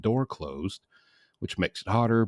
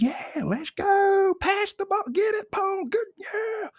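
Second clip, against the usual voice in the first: way higher sideline cheer, slow; imitating cheering dad; slower than usual. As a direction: cheer from the stands like a proud dad. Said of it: "just liked to show up and just".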